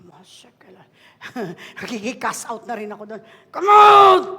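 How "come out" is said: loud, high exorcism command; commanding shout at a demon. Acted. Casting out demon.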